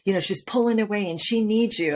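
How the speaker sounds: mimicking a friend who begs a favour; pleading friend. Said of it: "friends say".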